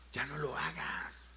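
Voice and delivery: slow hushed plea, almost breathless; urgent whisper. Real, not acted. Pastor to sinners, a slow urgent whisper. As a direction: whisper it slowly like a private warning. Pastor urging listeners to stop.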